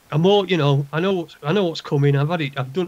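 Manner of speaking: ordinary talk, nothing marked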